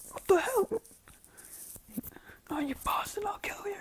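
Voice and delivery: strained, whispery mock yell; Bakugo's rage. Bakugo swears at the rival he is fighting, whispery mock rage. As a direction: threaten in a high, hushed snarl. Voices Bakugo raging after punch.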